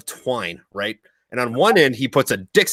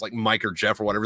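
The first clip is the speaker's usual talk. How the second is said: ordinary talk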